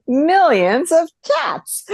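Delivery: high, slow, sing-song read-aloud; drawn-out bedtime story tune; storybook reading voice. Retold or imitated. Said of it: "always have this distinct memory of going".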